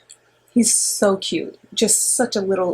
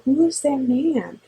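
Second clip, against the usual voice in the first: lightly voiced toddler; 7 semitones higher, slower than usual. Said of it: "And he was like".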